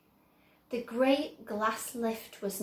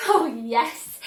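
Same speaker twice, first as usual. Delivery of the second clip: put-on louder, boyish eager reply; eager cry; 11 dB louder than usual. A narrator's reading. Charlie answering Wonka about the factory, eager cry. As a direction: burst out with joy. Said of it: "cried Charlie".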